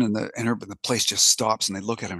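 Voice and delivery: usual speaking voice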